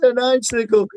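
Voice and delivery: a hint of a frantic mother; mom flipping out. Retelling the movie, a lightly voiced panicking mom. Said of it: "his mom's, like, flipping out".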